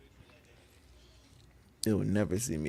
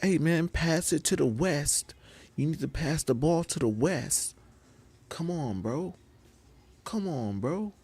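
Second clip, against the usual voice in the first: high-pitched pleading player; exasperated, high mock player's voice; mock basketball teammate; 8 semitones higher than usual. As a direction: whine at your teammates on the court. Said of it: "somebody be like".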